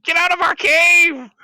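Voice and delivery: high, angry creature yell; screaming kobold. Acted. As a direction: defend the cave in a shrill kobold screech. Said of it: "they're all screaming".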